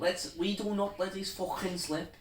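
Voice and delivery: put-on Scouse accent. Mimic as Gerrard rallying his team, Scouse accent. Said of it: "my name's Stevie Gerrard".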